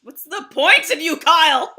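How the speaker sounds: loud laughing "What's the point of you"; mock scolding. To Kyle about the mead, loud laughing mock scold. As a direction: tease him loudly.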